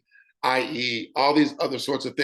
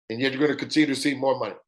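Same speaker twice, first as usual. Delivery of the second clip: ordinary talk, nothing marked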